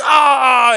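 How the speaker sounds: short loud high yelp of pain; high mock scream. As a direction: let out a sudden high shout. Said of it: "he's like".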